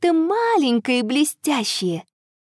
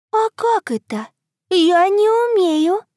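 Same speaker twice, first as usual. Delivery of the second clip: shy moon voice; 7 semitones higher, 4 dB louder than usual. Acted. Moon answers.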